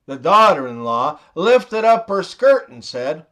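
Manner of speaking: normal talking voice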